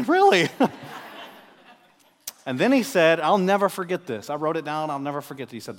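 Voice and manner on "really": high, loud, incredulous "really"; mock-astonished, pitched way up; retold disbelief. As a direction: play up your silent doubt out loud. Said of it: "And I was thinking".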